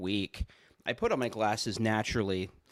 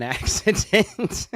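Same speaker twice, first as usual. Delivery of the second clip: usual speaking voice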